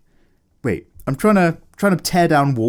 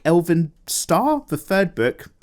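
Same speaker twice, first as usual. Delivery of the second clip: usual speaking voice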